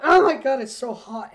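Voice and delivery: sudden loud, high outburst of oh my God; loud exclamation. Real, not acted. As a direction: blurt it out, overwhelmed by the heat. Complaining about heat in fursuit.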